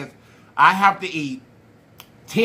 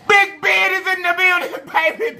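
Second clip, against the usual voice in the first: hype announcement; 9 semitones higher than usual. Acted. Announcing a guest.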